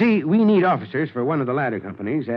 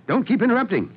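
testy, higher-pitched reprimand; irritated scolding; 9 semitones higher than usual. Acted. Chief scolds private for speaking up, irritated. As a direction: cut him off crossly.

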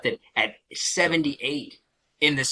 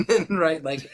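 ordinary talk

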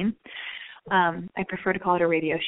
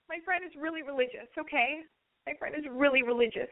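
high, softened imitation of a man confessing; mock-pained, high-pitched admission; reluctant confession; 6 semitones higher than usual.

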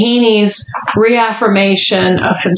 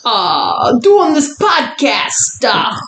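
put-on Jersey accent breaking into laughter, higher; 5 semitones higher, slower than usual. Hostess reading listener email aloud, laughing Jersey accent. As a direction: push through the giggles in a Jersey accent. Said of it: "Doing a Jersey accent of a man".

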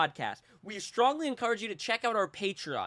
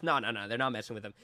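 lightly voiced smug denial; 10 semitones lower, 3 dB quieter, faster than usual. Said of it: "the creator goes".

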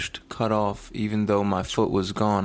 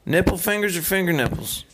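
higher-pitched country twang; country accent, pitched up; 7 semitones higher than usual. Shown as a demonstration. Co-host repeats the question for his friend, higher country accent. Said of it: "next question with that country accent".

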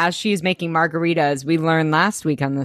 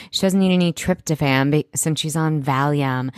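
usual speaking voice

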